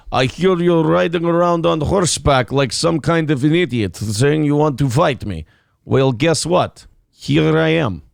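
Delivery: mock Russian accent, deliberate challenge; Putin in Russian accent. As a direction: play it as a comic Russian tough guy. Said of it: "you can do a Russian accent".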